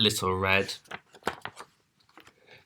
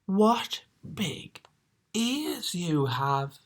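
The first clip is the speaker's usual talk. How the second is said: high, slow and wondering; 10 semitones higher, 5 dB quieter, much slower than usual. Acted. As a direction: draw it out in awe. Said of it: "she said slowly".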